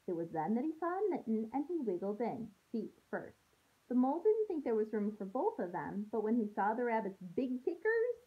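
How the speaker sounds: storybook read-aloud voice for a class; gentle story narration to kids; reading to children. A narrator's reading. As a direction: read it like a teacher sharing a picture book. Teacher reads picture book aloud.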